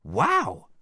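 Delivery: high, loud wow; impressed cry. A narrator's reading. Princesses see the wall broken, impressed cry.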